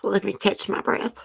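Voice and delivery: holding back real tears; getting emotional. Real, not acted. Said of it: "I'm getting emotional".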